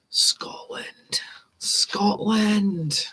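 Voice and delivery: hushed, drawn-out mock Scottish; breathy whispered Scottish place name; whispered Scottish accent. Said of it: "fake and horrible Scottish accent".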